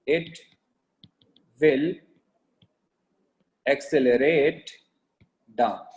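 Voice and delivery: word by word with long pauses; slow dictation. Real, not acted. Teacher giving notes to class, slow dictation. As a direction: dictate slowly, leaving a gap after each word.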